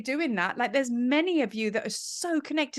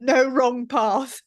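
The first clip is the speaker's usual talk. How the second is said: laughing through the words, louder and slow; delighted laugh as the card lands; 5 dB louder, much slower than usual. Real, not acted.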